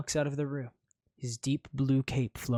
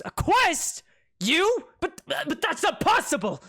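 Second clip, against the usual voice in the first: disbelief; 8 dB louder than usual. Acted. Prince in disbelief.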